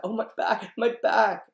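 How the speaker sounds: high, loud cry over a sore back; aching complaint. Acted. Nurse winding Juliet up about her back, hammed-up wail. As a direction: exaggerate the pain for attention.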